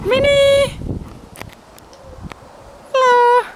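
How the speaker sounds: high, loud sing-song call to a cat. Real, not acted. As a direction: call her name up high across the garden. Calling the cat in garden.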